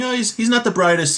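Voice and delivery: high-pitched, noncommittal "just saying" voice; mock offhand downplaying. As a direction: play someone lightly excusing their own put-down. Hypothetical gossip softening a put-down.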